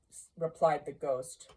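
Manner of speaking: plain reading voice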